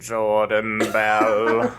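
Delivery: low, very slow mock professor drawl; slow, deep, theatrical villain-teacher voice; Professor Snape-style impression. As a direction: draw the name out low and ominous. Harry Potter professor impression.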